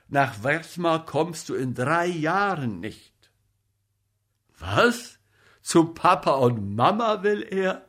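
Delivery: loud, incredulous comic actor talking down a friend; blustering colleague, exasperated; exclaiming comic actor. A narrator's reading.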